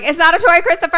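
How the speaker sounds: high, loud panicked scolding; alarmed scolding. Acted. Kids yelling at boy holding gun, alarmed scolding. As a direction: cry out to stop a child. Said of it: "they're like".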